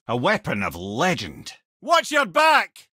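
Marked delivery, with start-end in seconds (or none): 1.8-2.9 shouted warning. Acted. Mimir shouting a warning to the boy in battle, loud and high. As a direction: yell it out, slow and high. Combat callout.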